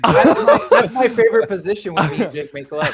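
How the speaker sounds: loud laughing innuendo. Acted. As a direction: blurt it out laughing.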